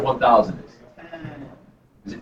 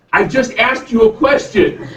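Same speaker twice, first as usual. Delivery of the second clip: put-on raised, indignant little-kid voice; imitating annoyed son; 8 semitones higher than usual. Dad voicing his son, who is annoyed that the question was dodged, pitched higher.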